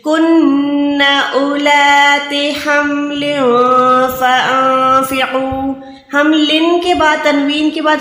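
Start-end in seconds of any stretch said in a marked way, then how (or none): 0.0-5.7 كُنَّ held nasal, the نون hidden before ف in فَأَنفِقُوا; slow recitation with ikhfa on فَأَنفِقُوا; tajweed ikhfa demo. Shown as a demonstration. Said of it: "یہاں پر بھی اقفا ہوگا".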